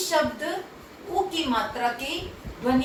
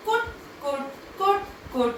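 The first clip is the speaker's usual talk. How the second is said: 1 mouse nibbling; 7 semitones higher than usual. Acted.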